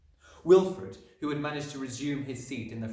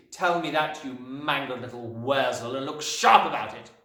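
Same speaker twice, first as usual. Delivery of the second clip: raised, hectoring tirade; raging Trunchbull; 6 semitones higher, 6 dB louder than usual. A narrator's reading. Headmistress correcting Wilfred's sum, raised and angry. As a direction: berate the child and demand his answer. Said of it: "yelled the Trunchbull".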